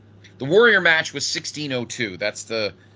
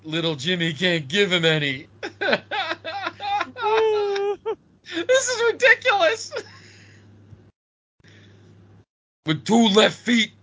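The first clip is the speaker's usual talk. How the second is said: laughing through the words. Real, not acted. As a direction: crack up as you quote. Laughing while quoting announcer.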